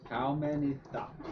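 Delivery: very slow, stretched repeat. Acted. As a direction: drag every word out. Repeats even slower.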